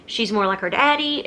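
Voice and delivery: ordinary delivery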